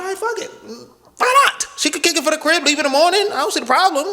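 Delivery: high, breezy self-talk; carefree inner voice. Retold or imitated. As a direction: shrug it off in a high voice. Inner voice, talking himself into it.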